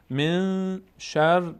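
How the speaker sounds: "من شر" slow, the ن held in the nose; ikhfa demonstration. Shown as a demonstration. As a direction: hum the hidden n of "من" before "شر".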